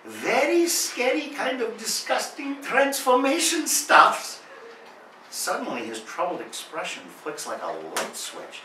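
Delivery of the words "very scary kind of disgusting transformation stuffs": put-on high, slow, careful Japanese-accented explanation; Japanese accent impression. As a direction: spell it out in a broken-English Japanese accent, voice up high. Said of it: "hazarding a clarification".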